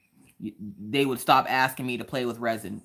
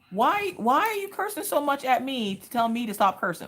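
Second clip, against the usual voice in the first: exasperated protest; 11 semitones higher than usual. Retold or imitated. Pleading protest to cursing dad.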